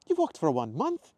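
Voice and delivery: high, loud astonished question; slow, incredulous rise in pitch; Scottish woman taken aback; gasped. Acted. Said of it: "Isla gasped".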